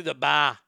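"bar" said as "bah". Shown as a demonstration. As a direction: drop the R in "bar", Boston style. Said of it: "letter R does not belong".

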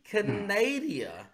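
slow Canadia with an added syllable, "kuh-NAY-dee-uh"; imitating a botched Canada; mimicking Canadia. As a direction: drag out the wrong Canadia. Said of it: "that you say".

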